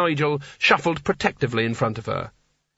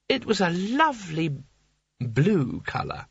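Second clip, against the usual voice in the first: slow, softer fond memory; wistful tipsy Seraph; 5 semitones higher, 3 dB quieter, slower than usual. A narrator's reading. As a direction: linger on it like a lost treasure.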